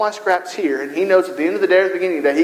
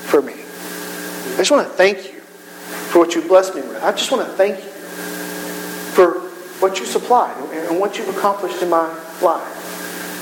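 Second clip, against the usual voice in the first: just thank God quietly; humble prayer; 3 dB quieter than usual. Retold or imitated. A believer coming before God only to give thanks, softly. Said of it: "just come before him and say".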